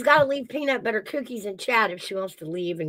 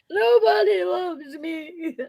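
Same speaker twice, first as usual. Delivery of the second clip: high, slow, crying wail; mock drunken wail; 10 semitones higher, much slower than usual. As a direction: wail it like a weepy drunk mom. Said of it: "My mom used to do that one".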